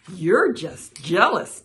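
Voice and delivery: loud, playful needling; teasing. A narrator's reading. Frog to annoyed Toad, loud and teasing. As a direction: tease the rival, punching it out. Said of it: "teased Frog".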